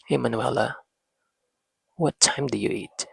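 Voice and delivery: soft, calm, loving ask. Shown as a demonstration. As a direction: ask it softly like a patient dad. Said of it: "in a loving, calm tone of voice".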